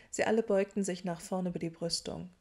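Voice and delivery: plain reading voice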